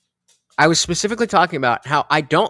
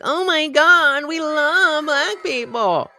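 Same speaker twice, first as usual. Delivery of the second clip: mock gushing liberal; 10 semitones higher, slower than usual. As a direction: parody a weepy, gushing liberal.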